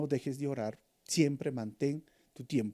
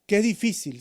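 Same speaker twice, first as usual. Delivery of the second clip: louder, higher Qué difícil with Qué hit hard; a raised, urgent Qué difícil; crying out; 5 semitones higher, 5 dB louder than usual. Acted. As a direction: cry out Qué difícil to the Lord. Repeated lament, soft then loud.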